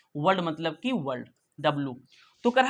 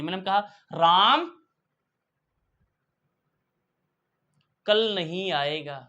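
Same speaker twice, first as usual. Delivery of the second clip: slow "Ram", a long pause, then "कल नहीं आएगा"; pause after Ram; slower than usual. Shown as a demonstration. Teacher showing the class where a dash pause falls. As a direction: hold a clear pause after Ram before going on. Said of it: "पहले राम दिया फिर pause लिया".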